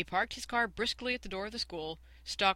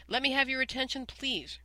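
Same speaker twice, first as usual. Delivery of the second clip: higher and louder, insisting on attention; louder repeat; 7 semitones higher, 3 dB louder than usual. Acted. Stewart repeating his call over the chattering class, raised. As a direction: call over the noisy room again. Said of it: "repeated Stewart".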